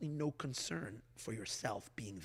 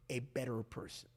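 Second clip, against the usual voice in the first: ordinary talk, nothing marked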